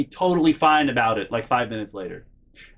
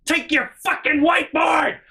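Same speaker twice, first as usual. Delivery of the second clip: high-pitched yell of outrage; furious retold shout; 13 semitones higher than usual. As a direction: act out screaming it at her in a fight. Acted driveway quarrel, throwing whiteboard.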